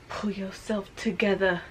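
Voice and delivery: a touch of real tears held back; getting emotional. Real, not acted. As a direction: try to steady a wobbling voice. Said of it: "getting emotional".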